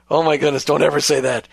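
normal talking voice

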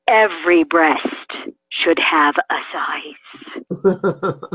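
slow, weighty pronouncement; tremendous conviction. Retold or imitated. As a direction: state it slowly with solemn conviction, imitating him. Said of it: "he said with tremendous conviction".

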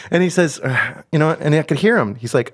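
ordinary talk

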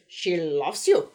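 slow, heavy mock German accent; bad German accent. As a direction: sing it in a hammy German accent. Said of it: "the bad, uh, German accent".

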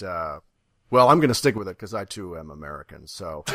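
ordinary talk, nothing marked

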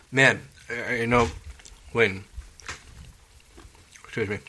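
talking around a chewed tablet; chewing while talking. Real, not acted. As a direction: speak with a Tums in the mouth. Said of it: "I'm chewing a Tums".

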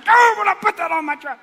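high, loud mock wail; exasperated shout. Driver caught out by the church sticker, wailing. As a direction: burst out in comic frustration.

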